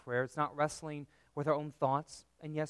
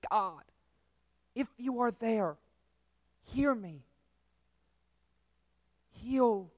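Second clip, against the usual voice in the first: higher, straining plea to God; pleading prayer; 6 semitones higher, 3 dB louder than usual. Acted. Daughter beside her dying parent pleading with God. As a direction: reach up desperately, voice lifted.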